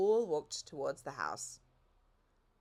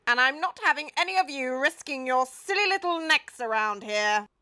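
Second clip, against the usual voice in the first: put-on louder, higher old Duke; the Duke's voice; 7 semitones higher, 9 dB louder than usual. A narrator's reading. The Duke praises the cherry picking, in character.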